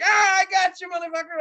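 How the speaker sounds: high, loud gloating friend's yell; gloating shout. Retold or imitated. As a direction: crow it triumphantly.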